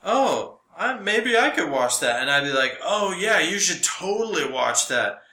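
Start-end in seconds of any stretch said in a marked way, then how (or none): none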